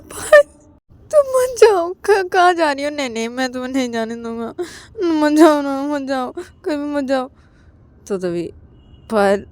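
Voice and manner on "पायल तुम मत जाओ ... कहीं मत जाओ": very high loud sobbing call; crying plea. Acted. Ananya crying after Payal as she leaves. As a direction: wail for her to stay.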